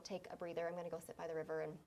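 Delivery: put-on whispered, fast, so the kids won't hear; mother whispering to husband outside the camper; hushed. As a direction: murmur it low and quick, then fade to a breath. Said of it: "And I just said".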